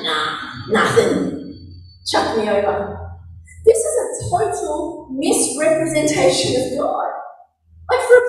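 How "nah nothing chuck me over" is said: low, drawn-out offhand refusal; dismissive Jonah. Acted. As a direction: brush them off slowly in a low voice. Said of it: "And Jonah's going".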